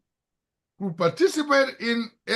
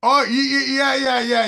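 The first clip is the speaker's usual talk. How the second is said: loud, higher stumbling yeses; quick relieved agreement, raised in pitch and volume; eager acceptance; 6 semitones higher, 5 dB louder than usual. Real, not acted. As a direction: jump at the offer of help. Eagerly accepting offered help.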